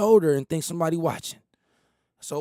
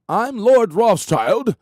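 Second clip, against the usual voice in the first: pompous lord; 5 semitones higher, 7 dB louder, much slower than usual. Retold or imitated. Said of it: "going around talking about".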